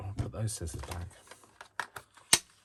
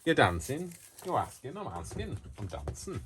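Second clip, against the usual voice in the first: TV catchphrase; 8 semitones higher than usual. Retold or imitated. Quoting a UK sitcom, lightly voiced catchphrase. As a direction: toss off the old sitcom line. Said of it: "from another T V programme".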